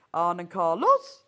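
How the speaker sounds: exaggerated Norwegian lilt, "Carlos" swooping up at the end; rising Norwegian accent. Shown as a demonstration. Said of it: "Norwegian has a distinctive rising pitch".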